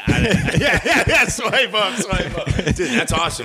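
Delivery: cracking up while talking; laughing. Real, not acted. Laughing at the Nike joke.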